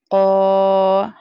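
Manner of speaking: क़ा held long, drawn out; stretched qaa. Shown as a demonstration. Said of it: "क़ाफ़ को इसलिए खींच कर पढ़ा". (क़ा को खींच कर लंबा पढ़ना)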